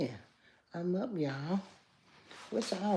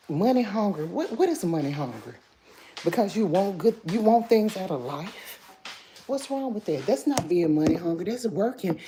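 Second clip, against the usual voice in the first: indignant; 3 semitones higher, 9 dB louder than usual. Real, not acted. Pushing back at a hater.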